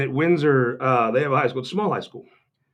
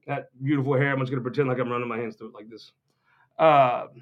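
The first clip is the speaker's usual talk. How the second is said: ordinary talk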